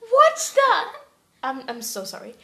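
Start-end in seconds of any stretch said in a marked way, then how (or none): 0.1-0.8 high, loud startled shout; startled outburst. Acted. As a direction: jump and cry out in shock. Sister barges in again.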